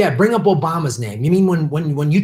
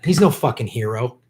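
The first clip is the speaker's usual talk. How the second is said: ordinary talk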